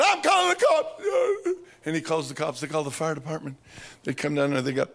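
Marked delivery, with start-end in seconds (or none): 0.0-1.0 high, loud alarmed shout; shouting boss. Retold or imitated. Boss yelling he'll call the police, high and loud. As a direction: yell it in a panic. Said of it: "He said".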